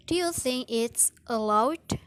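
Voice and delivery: doubtful question ending upward on "allowed"; fall-rise of doubt. Shown as a demonstration. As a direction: ask it loudly with a rise at the end of "allowed". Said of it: "fall rise intonation is in politeness".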